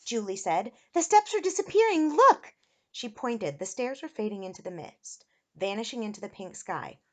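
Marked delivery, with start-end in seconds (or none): 0.9-2.5 high loud cry, peaking on the last word; alarmed warning. A narrator's reading. Julie sees steps disappearing, alarmed high shout. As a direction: call out a frantic warning. Panicked warning during the escape.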